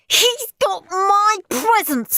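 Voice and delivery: high, slow whining scream. Acted. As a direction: wail to the grown-ups. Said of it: "screamed Steve".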